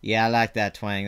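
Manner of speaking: ordinary talk, nothing marked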